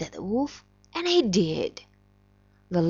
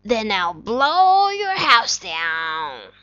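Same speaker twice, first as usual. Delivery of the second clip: wolf voice; 5 dB louder, slower than usual. Acted. Wolf threatens the pigs.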